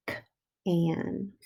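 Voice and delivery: K-an said softly, sound by sound; quietly segment K-an; soft phonics demo. Shown as a demonstration. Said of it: "write the sounds that you hear".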